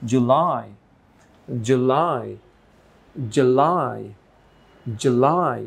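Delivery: July with LAI punched clearly; short "ju", strong falling "LAI" in July; stress on second syllable. Shown as a demonstration. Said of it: "একটু জোর দিয়ে clearly".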